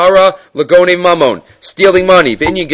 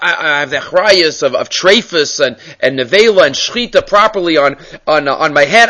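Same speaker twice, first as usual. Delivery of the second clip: normal talking voice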